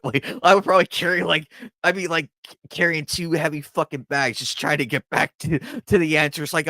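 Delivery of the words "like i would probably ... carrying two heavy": fast, louder, laughing through the words; amused, tumbling out. Real, not acted. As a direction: giggle as you rush it. Laughing at own greed.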